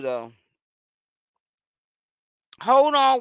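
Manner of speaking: ordinary delivery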